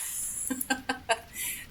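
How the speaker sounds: short laugh up high; high breathy laugh. Real, not acted. Gamer laughing at a guide's hint.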